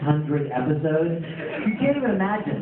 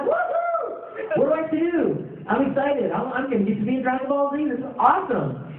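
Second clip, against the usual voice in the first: much higher, shouted woohoo, then fast thrilled chatter; excited; 8 semitones higher than usual. Retold or imitated. Actor hearing he got the Broly role, much higher and thrilled. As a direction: bounce off the walls with glee. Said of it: "I'm like".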